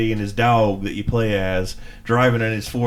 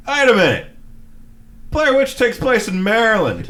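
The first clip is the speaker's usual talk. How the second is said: higher, louder "wait a minute" double take; mock surprised realization; 10 semitones higher, 3 dB louder than usual. Retelling spotting the wrong forest, a loud double take. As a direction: catch the mistake, half-mock surprised. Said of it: "And I'm like".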